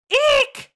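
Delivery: very high shrill eek like a horror violin; shrieking eek. Pretend. Mimicking a slasher film stab for the co-host, high squeal. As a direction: shriek it like the Psycho strings.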